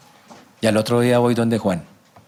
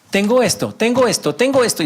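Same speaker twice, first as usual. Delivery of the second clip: much higher, insistent repetition; mock repetitive pleading; 11 semitones higher than usual. Believer repeating the same problem to God, mock insistent. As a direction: hammer out the same complaint, pitched high. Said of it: "Y la misma vaina".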